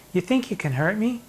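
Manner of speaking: usual speaking voice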